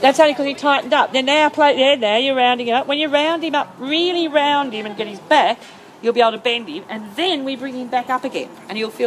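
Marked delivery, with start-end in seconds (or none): 0.0-7.4 higher, louder, eager; excited and loud. Real, not acted. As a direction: push the point with energy. Coach explaining excitedly.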